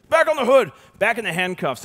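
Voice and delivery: loud, high barked order; barked command. Retold or imitated. Constable ordering him back in cuffs, loud bark. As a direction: bark it like a cop. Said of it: "it sounds better when they say".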